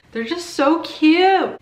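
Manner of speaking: high, loud, drawn-out gush. Real, not acted. Reader gushing to viewers about Declan and Iris, gushing. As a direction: swoon over them, slowly and loudly. Gushing over favourite book couple.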